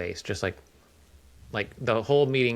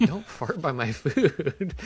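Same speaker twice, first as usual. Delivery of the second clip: slow, higher-pitched mock plea; exasperated parent scolding; 6 semitones higher, much slower than usual. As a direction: drag out each word like a fed-up parent. Acts out scolding kids at dinner.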